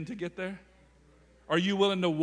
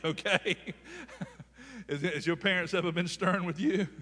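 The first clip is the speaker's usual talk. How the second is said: normal talking voice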